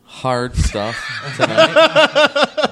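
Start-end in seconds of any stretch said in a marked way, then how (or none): 1.2-2.7 high, slow laughing words. Real, not acted. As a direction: laugh your way through it. Laughing through drink reveal.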